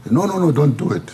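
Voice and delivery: a retold father cutting his son off; father's stern refusal. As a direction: forbid it like an alarmed father. Angry father forbids poetry.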